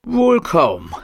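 dry refusal. Acted. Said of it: "sagte Abigails Onkel".

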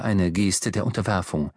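plain reading voice

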